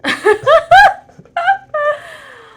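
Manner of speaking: high, loud burst of laughter; shrieking laugh. Real, not acted. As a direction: crack up at the sight. Sees partner's mask, bursts out.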